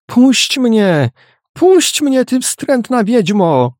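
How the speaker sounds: very high, desperate outcry; loud cry. A narrator's reading. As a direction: cry out high as if struggling to get free. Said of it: "Nagle rozległ się głośny okrzyk". (głośny, bardzo wysoki okrzyk)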